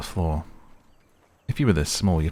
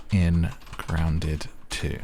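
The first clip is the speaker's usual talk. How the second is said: usual speaking voice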